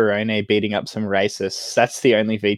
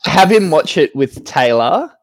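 normal talking voice

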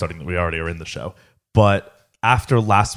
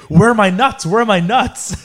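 much higher, louder impatient asking; excited whine; 11 semitones higher, 5 dB louder than usual. Retold or imitated. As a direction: demand it like an eager kid. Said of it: "today he's like".

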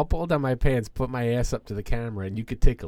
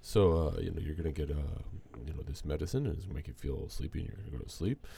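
calm doctor; 6 semitones lower, 6 dB quieter than usual. Retold or imitated. Doctor explaining the procedure.

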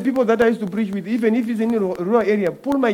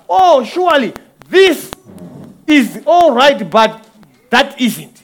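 fiery preaching; 3 semitones higher, 4 dB louder than usual. Acted. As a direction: preach it like calling witnesses to the stand. Preaching at full force.

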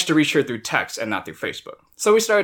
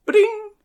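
high sing-out email ding; notification chime imitation. Friend mimics his email notifications arriving, high chime imitation. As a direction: imitate a phone alert, pitched way up. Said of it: "email notifications".

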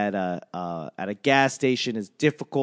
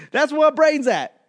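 higher and louder, exclaimed; exclaimed punchline; 12 semitones higher, 5 dB louder than usual. Acted. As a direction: shout the punchline, then repeat it. Punchline, repeated twice.

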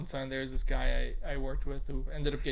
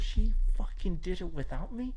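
indignant surprise; 5 semitones higher than usual. Retold or imitated. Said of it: "And she goes".

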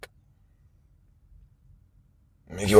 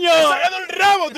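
boastful shout; 13 semitones higher than usual. Real, not acted. Winner boasting loudly.

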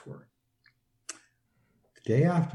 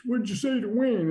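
a hint of the wife scolding, pitched up; imitating the angry wife; 11 semitones higher than usual. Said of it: "his wife yelled at us".

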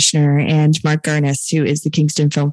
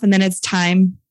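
normal talking voice

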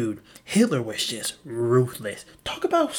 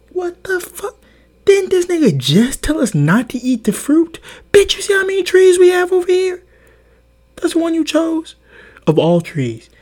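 much higher, louder exasperated shouting at Eve; furious Adam; 12 semitones higher, 11 dB louder than usual. Acted. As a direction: rant at her like a betrayed husband. Adam scolding Eve over the fruit.

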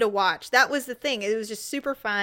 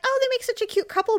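much higher, quick gushing coo; 13 semitones higher, faster than usual. Retold or imitated. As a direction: gush it high and fast. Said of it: "I was like".

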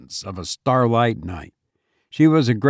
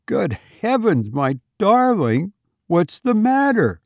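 startled husband calling out, pitched higher; husband finds his wife weeping, cries out in alarm; alarmed cry; 8 semitones higher than usual. A narrator's reading. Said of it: "I cried".

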